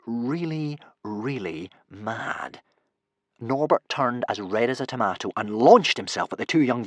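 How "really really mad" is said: drawn out. A narrator's reading. Narrator slows and drops for effect.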